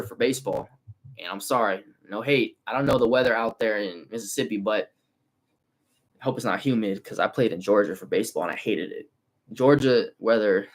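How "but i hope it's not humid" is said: ordinary talk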